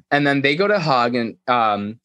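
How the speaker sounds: ordinary delivery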